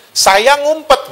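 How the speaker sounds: high-pitched sheepish reply; Adam's confession. Acted. Adam admitting to God that he hid, high put-on voice. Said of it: "Adam sudah dengerin iblis duluan".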